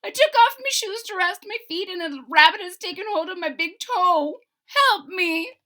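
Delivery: high, loud little voice begging; leprechaun pleading. A narrator's reading. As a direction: plead with a tiny man's high voice. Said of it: "cried the little man".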